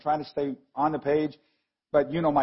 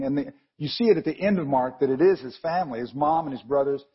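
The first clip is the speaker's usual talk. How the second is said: ordinary talk, nothing marked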